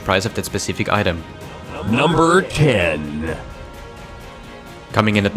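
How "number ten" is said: slow, dramatic announcer voice; drawn-out countdown announcer call; top ten countdown. Acted. Top ten list countdown card.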